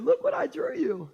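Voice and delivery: put-on little girl voice. Daughter voiced showing drawing.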